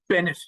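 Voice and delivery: BENNETT high and loud, falling at the end; repeated name call. Retold or imitated. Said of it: "over and over again".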